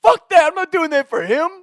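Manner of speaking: high, loud furious yell; screaming back. Retold or imitated. Trey screaming back at the coach, furious yell. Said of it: "screaming back at our coach".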